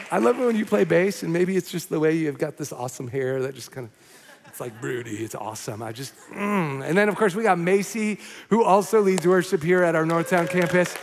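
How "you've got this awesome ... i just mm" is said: low, soft admiring murmur; quiet, low-pitched savouring; hushed aside. Real, not acted. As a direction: drop right down in playful admiration. Playful aside admiring bassist's broody hair.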